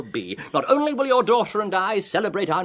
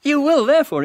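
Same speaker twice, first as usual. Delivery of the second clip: indignant; 10 semitones higher, 4 dB louder than usual. Acted. Colonel indignant at Foma.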